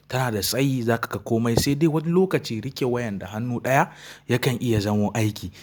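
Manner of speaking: normal talking voice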